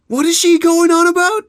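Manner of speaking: loud, very high-pitched puzzled heckle; baffled outcry. Acted. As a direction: call it out high in disbelief. Workers grumbling among themselves.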